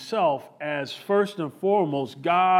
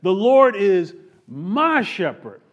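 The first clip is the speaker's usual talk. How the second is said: louder, slow, proclaiming "The Lord is my shepherd"; proclaimed declaration; 3 semitones higher, 7 dB louder, slower than usual. Acted. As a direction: announce it like David's confession of faith. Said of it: "is inspired by God and says".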